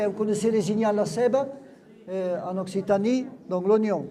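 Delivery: normal talking voice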